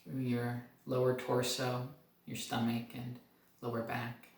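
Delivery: soft, calm meditation guide; guided meditation. Acted. Doctor leading viewers up through the body, calm and soft. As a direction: lead the body scan gently, letting pauses breathe.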